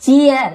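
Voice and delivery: चीयर loud and high, chi-yar; drawn-out ईयर ending. Shown as a demonstration. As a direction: model चीयर with a strong ya glide. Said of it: "ईयर ऐसा लंबा खींच रहे हैं". (चीयर में ईयर लंबा खींचकर)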